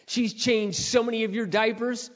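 heated preaching, rising in force; forceful preacher's scolding. Real, not acted. Scolding exhortation to young listeners.

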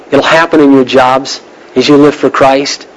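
ordinary talk, nothing marked

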